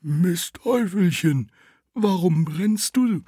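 the little tree asks the burning dung heap, high and slow. Acted. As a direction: ask gently and slowly in a high voice. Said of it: "Bäumchen ... und sprach".

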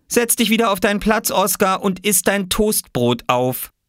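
high-pitched impatient orders; cross mother. Acted. Mama sending Oskar back to his seat, cross. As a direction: order a child back to the table.

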